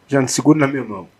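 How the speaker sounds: a brother's urgent plea to his dying sister. Retold or imitated. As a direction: urge her to hold on, pressing the words out quickly. Urging dying sister by phone.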